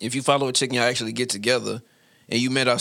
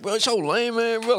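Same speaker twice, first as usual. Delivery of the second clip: mock girl complaining; 9 semitones higher than usual.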